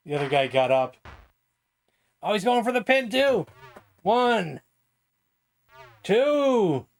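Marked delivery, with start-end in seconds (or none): 2.2-6.9 high, excited ringside call; wrestling commentator. Acted. Commentator counting the pin.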